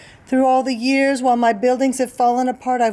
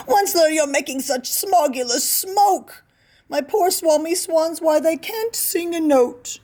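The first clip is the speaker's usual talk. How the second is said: higher, croaking complaint, slowing at the end; croaky Lorax complaint; 8 semitones higher than usual. Acted. Lorax scolds the Onceler about the smoke, higher croaky whine. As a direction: whine it out like a wheezing little creature. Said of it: "he cried with a cruffulous croak".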